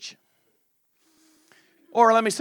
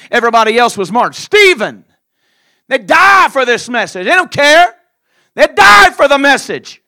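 loud, driving sermon; full-force preaching; 11 dB louder than usual. Acted. Preacher driving the martyrs point home, loud. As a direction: hammer it home to the congregation.

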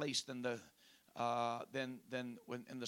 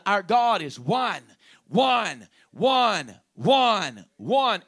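very loud, higher chant pounding "one"; emphatic declaration; 6 semitones higher, 15 dB louder, much slower than usual. Retold or imitated. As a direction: thunder each "one" over and over. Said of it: "they are emphatically declaring over and over".

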